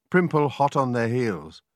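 plain reading voice

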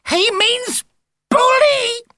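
very high, loud squawk; squawking wife. A narrator's reading. Mrs Tibblethwaite squawking to Monster Mash, very high and loud. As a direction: squawk it out in a panic. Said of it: "squawked Missus Tibblethwaite".

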